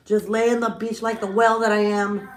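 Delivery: lightly put-on goofy voice; mock whale. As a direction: ham up being a beached whale. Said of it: "Just lay there like a whale".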